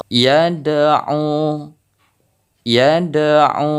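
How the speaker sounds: "yad'u" with a small bounce on the d; light qalqalah on dal. Shown as a demonstration. Teacher demonstrating minor qalqalah, small bounce. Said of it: "pantulannya tidak terlalu besar".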